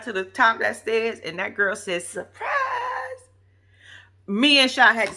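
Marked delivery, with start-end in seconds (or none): 2.0-3.3 high, sing-out announcement; mimicked surprise reveal. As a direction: imitate a gleeful big reveal, way up high. Mimics girl revealing the dress.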